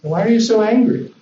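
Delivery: put-on high, coaxing question to a child; concerned parent. Parent to angry child who poked his brother, concerned coaxing. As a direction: ask without any wish to crush. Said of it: "You're not there to crush him".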